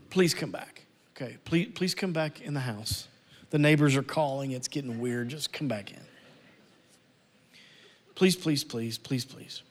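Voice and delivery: hushed, low pleading to a sulking brother; mock pleading. Pastor imitates a father begging his sulking son, hushed pleading. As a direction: coax him back in, pleading softly.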